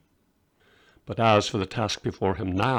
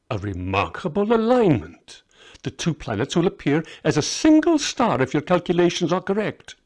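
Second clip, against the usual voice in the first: put-on half-blind old magus; 6 semitones higher, 3 dB louder than usual. Half-blind Balthazar speaks.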